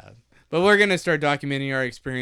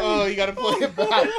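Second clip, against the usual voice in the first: ordinary talk, nothing marked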